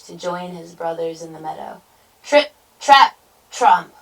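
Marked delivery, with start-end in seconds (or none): 2.2-3.9 loud, separated stomps; staccato trip-trap-tromp; hoofbeat sound words. A narrator's reading. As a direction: punch each hoofstep, landing heavy. Big goat's hoof sounds.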